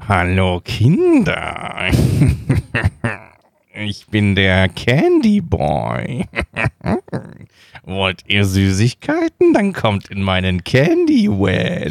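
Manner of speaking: put-on low, slow, creepy child-luring voice; creepy candy man. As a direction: play the sleazy candy van man, slowly. Sketch, candy man luring kids.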